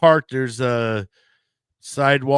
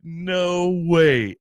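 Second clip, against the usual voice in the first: loud laughing disbelief; 6 semitones higher, 5 dB louder, much slower than usual. Retold or imitated. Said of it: "I'm just like".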